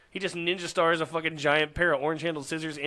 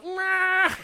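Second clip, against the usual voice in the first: kiss sound; 4 dB louder than usual. Retold or imitated. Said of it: "the dad goes".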